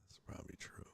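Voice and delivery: whispered "That's probably true". Real, not acted. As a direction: breathe it out unvoiced. Whispered repeat.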